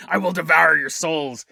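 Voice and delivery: low demonic beast voice. Acted. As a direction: growl it like a demon. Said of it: "it's the Antichrist".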